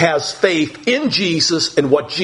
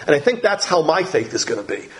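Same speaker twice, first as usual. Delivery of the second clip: ordinary talk, nothing marked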